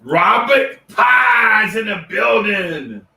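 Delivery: slow, stretched ring-announcer shout; drawn-out hype announcement. Real, not acted. Streamer introducing a viewer to chat, slow stretched hype call. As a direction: drag every word out like a ring announcer.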